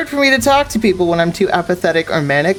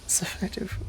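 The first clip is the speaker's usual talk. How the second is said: whisper; 15 dB quieter than usual. Real, not acted. Player muttering over the game.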